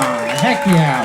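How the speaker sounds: usual speaking voice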